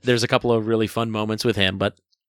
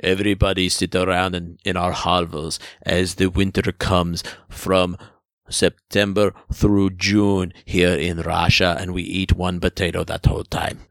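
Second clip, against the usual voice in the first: a lightly put-on Russian accent, slowing on the gloomy parts; playful mock Russian accent; 4 semitones lower than usual. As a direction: imitate a dour Russian, half joking. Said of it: "No, your accent".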